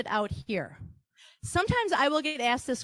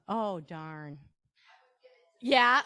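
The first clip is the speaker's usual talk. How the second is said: ordinary talk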